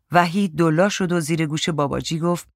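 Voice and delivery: usual speaking voice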